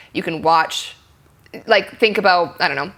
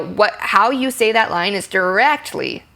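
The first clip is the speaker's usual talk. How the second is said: normal talking voice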